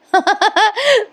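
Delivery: short high-pitched laugh; amused laugh. Real, not acted. Narrator amused by the office-boy scene, high laugh. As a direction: burst into a brief, high laugh.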